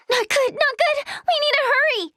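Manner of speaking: high, pressing urgency; urgent, high-pitched warning; urgent alarm. Acted. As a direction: rush them along, pitched well up. Character urges others to hurry.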